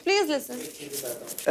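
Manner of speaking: soft, slow, coaxing "please listen"; gentle pleading to a partner mid-fight; very gently. Real, not acted. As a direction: coax him softly, slowing right down. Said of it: "मैं बहुत प्यार से बोल रही हूँ".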